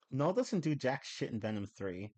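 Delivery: normal talking voice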